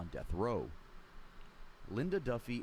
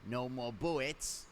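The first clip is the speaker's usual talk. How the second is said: slow cartoon voice with softened consonants; slow, babyish mangling of the words; Elmer Fudd; much slower than usual. Shown as a demonstration. Narrator impersonating it for the viewers, cartoon impression. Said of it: "I'm gonna impersonate it for you guys".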